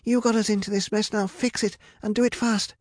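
high-pitched, urgent insistence; protesting. A narrator's reading. Rapunzel demands Medea fix the mess, urgently protesting. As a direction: throw the blame back and demand action. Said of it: "protested Rapunzel".

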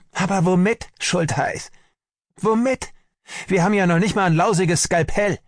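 very high-pitched, frantic outcry; desperate shout. A narrator's reading. Army doctor despairs with no scalpel to operate, desperate shout. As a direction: cry out in helpless fury. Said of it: "schlug er sich ... und schrie".

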